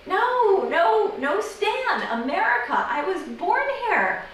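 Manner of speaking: high-pitched insistent denial; frustrated, pitch leaping up; exasperated protest. Acted. Protests wrong guesses at origin.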